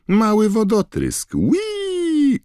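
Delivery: very high, loud squeal; gleeful poltergeist. A narrator's reading. Poltergeist hurls another water bomb, squealing gleefully. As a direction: squeal "whee" like a naughty imp.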